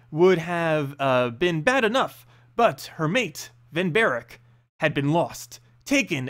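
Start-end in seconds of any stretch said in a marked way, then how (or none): none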